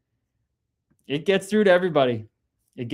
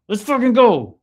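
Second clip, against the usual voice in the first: even higher, louder hype shout; hyped yell; 9 semitones higher, 7 dB louder than usual. Real, not acted. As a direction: belt it out, all fired up. Hyped by a chat comment.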